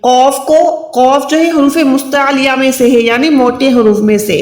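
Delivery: usual speaking voice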